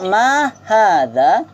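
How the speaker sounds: slow, drawn-out Arabic drill phrase; slow model for repeating. Shown as a demonstration. Teacher models the phrase slowly for students to repeat. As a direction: spell out each syllable for the class to echo. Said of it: "cuba ikut sama-sama".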